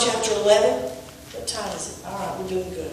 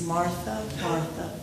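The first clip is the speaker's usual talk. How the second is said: gentle rebuke; 5 semitones lower, 12 dB quieter, slower than usual. Acted. Jesus gently addresses Martha.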